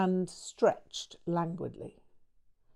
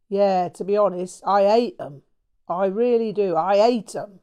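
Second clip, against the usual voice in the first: louder, stressing hate; bitter hatred; 8 dB louder than usual. Acted. Son telling his friend he hates his parents, louder bitter declaration. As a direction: hammer the word hate home.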